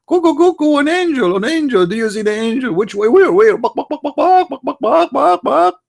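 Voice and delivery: high squeaky animal voice; high, hammed-up animal panic; mock barnyard animal. Donkey spotting an angel, high hammed-up squawk. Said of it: "the donkey will say".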